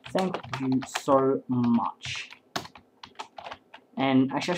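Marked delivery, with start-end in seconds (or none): 0.1-2.3 very slow, savoured words; mock acceptance speech. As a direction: draw out every word like an award winner.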